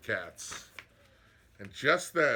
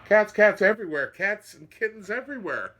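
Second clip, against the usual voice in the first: high, loud dismayed cry; exasperated old woman; 9 semitones higher, 10 dB louder than usual. Acted. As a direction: throw up your hands at all the cats.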